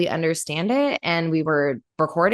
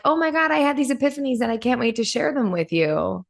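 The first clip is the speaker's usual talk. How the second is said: higher-pitched, lightly acted excitement; mock gushing; 6 semitones higher, faster than usual. Recalling yesterday's eagerness to share epiphanies, mildly gushing. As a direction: play at bubbling over with news.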